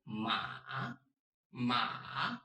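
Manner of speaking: "ma" long, dipping then rising; third tone. Shown as a demonstration. Teacher models ma like a hesitant well, long dip and rise. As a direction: give "ma" the hesitant curve of a thinking "well". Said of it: "Exactly the same".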